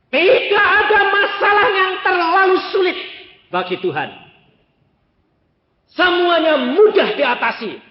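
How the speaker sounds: loud, high declaration of faith; full-force preaching. Acted. Preacher assuring the congregation nothing is too hard, loud proclamation. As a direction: ring it out over the church.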